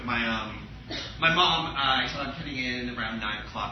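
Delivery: normal talking voice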